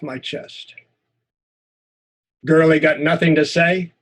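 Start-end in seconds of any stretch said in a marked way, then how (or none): 2.4-3.9 bully's jeer. Acted. Bully taunts the boy.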